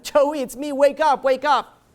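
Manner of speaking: high, loud, urgent retold cry; crying plea. Crying wife calls to husband in coma, acted urgent plea. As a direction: act out a desperate call to wake him. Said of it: "she's running and she's crying".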